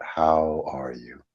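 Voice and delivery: soft, slow, inward asking; gentle meditative question. Acted. Guiding listeners to feel the question, softly. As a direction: let it land gently.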